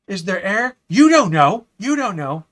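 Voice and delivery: high, loud hammed-up movie alarm; mock panic. Streamer quotes a loved Galaxy Quest scene, mock-panicked shouting. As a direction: shout it like a panicked film character.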